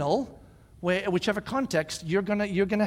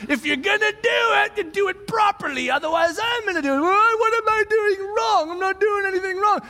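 much higher, loud scolding rant; retold angry shout; 14 semitones higher, 7 dB louder than usual. Dad and daughter fighting in the kitchen, angry shout. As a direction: bark orders over the mop. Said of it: "I'm like".

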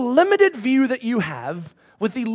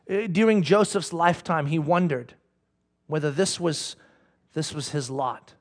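ordinary talk

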